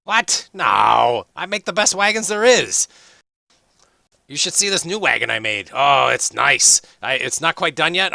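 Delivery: high, loud, eager dwarf voice; chatty young dwarf. Acted. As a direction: bounce in as the cheerful young dwarf. Hori voiced throughout.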